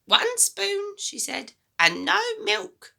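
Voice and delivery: put-on high, loud, bossy old woman; a mean grandma giving orders, high and loud; grumpy grandma voice. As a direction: nag the boy like a sour old granny.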